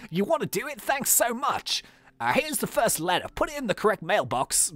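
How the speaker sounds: amateur Australian character voice; attempted Australian accent. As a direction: gush thanks in a put-on Australian accent. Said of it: "I'll try and do Australian".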